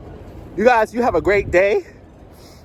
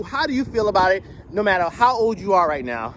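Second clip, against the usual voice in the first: ordinary delivery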